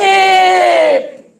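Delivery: high, long-held loud cry; desperate wake-up shout. Acted. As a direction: shout to wake him, stretching the last syllable. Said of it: "다시 예수님을 깨웁니다". (다급하게 길게 외쳐 부르기)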